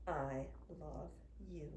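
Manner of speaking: ordinary delivery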